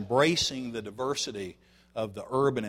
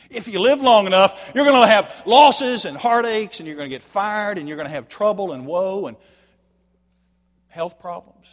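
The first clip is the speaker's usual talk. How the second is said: louder, higher sermon climax; full-force preaching; 7 semitones higher, 13 dB louder than usual. Acted. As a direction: drive the point home from the pulpit. Preacher builds on life's losses.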